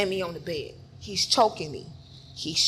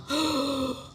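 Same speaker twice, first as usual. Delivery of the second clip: mimed gasp for air; winded gasp; 4 semitones higher than usual. Retold or imitated. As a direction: gasp as if punched in the gut. Winded by a solar plexus blow.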